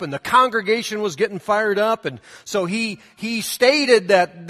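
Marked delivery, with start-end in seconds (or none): none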